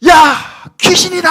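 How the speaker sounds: high, loud frightened cry of ghost; terrified cry. Acted. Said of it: "하면서 두려워하면서 막, 막 울부짖어".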